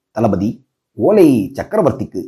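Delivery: low, modest address to a superior; meek, deferential. A narrator's reading. Messenger telling the commander whom the letter is for, meekly. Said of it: "அடக்க ஒடுக்கத்துடன்".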